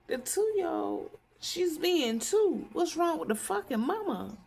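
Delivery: retold indignant retort. Retelling a retort about a toddler's mama, indignant and high. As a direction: stretch the words in high-pitched outrage.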